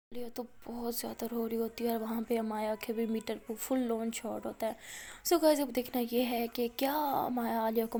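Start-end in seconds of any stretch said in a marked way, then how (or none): none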